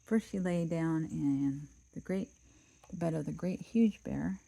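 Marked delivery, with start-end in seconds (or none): none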